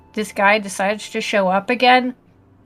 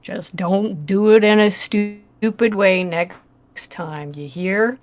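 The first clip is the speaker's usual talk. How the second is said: badger voice; 2 semitones lower than usual. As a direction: drawl it out like a weary badger. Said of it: "he's like".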